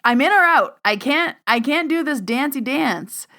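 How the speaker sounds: emphatic. Real, not acted. Firm stance on mediumship.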